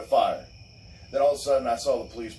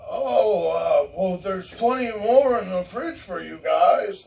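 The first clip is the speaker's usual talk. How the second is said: higher, slow, nervous mock neighbor; 7 semitones higher, 4 dB louder, slower than usual. Timid neighbors offering drinks, mocked higher and slow. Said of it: "they're like".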